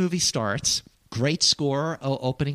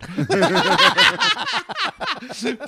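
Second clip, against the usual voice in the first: high burst of laughter; loud laugh; 6 dB louder than usual. Real, not acted. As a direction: crack up at the punchline. Laughs at own joke.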